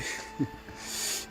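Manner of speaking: short, soft breathy laugh; real laugh. Real, not acted. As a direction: laugh it off briefly. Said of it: "je rigole parce que". (petit rire pour ne pas se laisser envahir)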